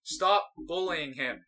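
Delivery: imitating a man's drawn-out order; retold yell. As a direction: call it slowly across a distance. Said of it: "and then he keeps going".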